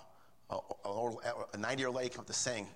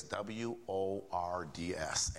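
slow, deliberate letter-by-letter spelling; measured, lowered spelling for emphasis; spelled out slowly; 5 semitones lower, much slower than usual. Real, not acted. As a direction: spell it out one letter at a time, low and slow. Spells out the key word.

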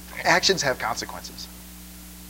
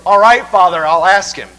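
Irishman's reply; 3 semitones higher, 13 dB louder than usual. Retold or imitated. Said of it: "he goes".

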